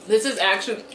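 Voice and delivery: eating mid-sentence; chewing while talking. Real, not acted. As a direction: mouth partly full. Seen 0: Right speaker bit food earlier; lips press and work between words.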